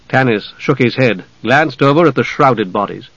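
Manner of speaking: ordinary delivery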